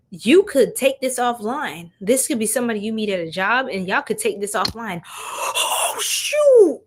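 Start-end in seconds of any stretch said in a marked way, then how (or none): 5.1-6.8 sharp gasp, then high loud exclamation; high, loud, drawn-out shock; startled realization. Real, not acted. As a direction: gasp and cry out as it hits you. Sudden realization during the reading.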